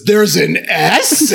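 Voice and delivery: hammed-up puzzled rise on the S; mock disbelief. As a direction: imitate a baffled friend, stretching it out. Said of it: "Jesse looks and says".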